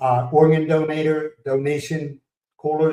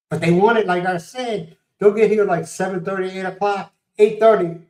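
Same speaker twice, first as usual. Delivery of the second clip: exasperated; 6 semitones higher than usual. Real, not acted. Griping to the camera about workers arriving early, higher and exasperated. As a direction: pile up the times, climbing higher.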